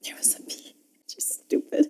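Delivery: whispered echo of the film, breathy and hushed; mock whisper. Viewer whispering along with the movie characters, hushed mockery. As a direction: hush it like a secret aside. Echoing the film's whispered line.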